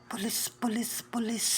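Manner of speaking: whispered chant; hushed repeated whisper. Real, not acted. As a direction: whisper it over and over.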